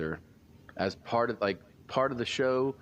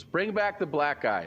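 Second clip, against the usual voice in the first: mock heckler call; 7 semitones higher than usual. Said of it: "this first person goes".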